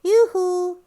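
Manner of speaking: plain reading voice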